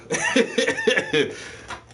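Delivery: high, loud laugh; a real, high-pitched laugh breaking out; burst of laughter. Real, not acted. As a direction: crack up with a long laugh. Laughing at millionaire story.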